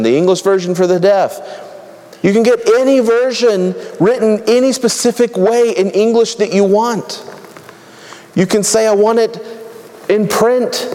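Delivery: high-pitched, driving sermon list; animated pulpit delivery, pitched high; preaching. Acted. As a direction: preach it as a mounting roll call of options. Rapid-fire sermon list of options.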